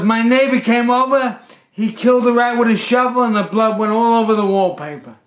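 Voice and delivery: high, loud outraged retelling; angry accusation. Retold or imitated. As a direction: imitate an irate, frantic customer. Angry customer explaining blame.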